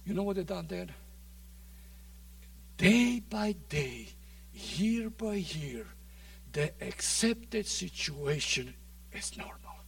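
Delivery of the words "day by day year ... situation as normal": hushed. Acted. Preacher drops to a hush.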